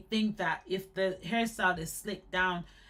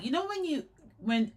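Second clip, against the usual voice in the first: ordinary talk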